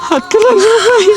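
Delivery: high, drawn-out crying lament; slow sobbing wail. Acted. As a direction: wail the loss slowly through tears. Man wails over lost lakhs. (ಅಳುತ್ತಾ ನಿಧಾನವಾಗಿ ಗೋಳಾಟ)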